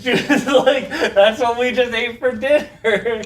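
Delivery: giggling as the punchline lands; laughing. Real, not acted. Telling a friend grandma's rabbit story, laughing.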